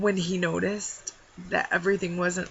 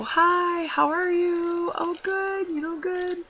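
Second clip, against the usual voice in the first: bright high service voice; sing-song friendly greeting at work; chirpy customer greeting; 11 semitones higher, 3 dB louder, slower than usual. Shown as a demonstration. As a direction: greet like a cheerful cashier. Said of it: "I normally go".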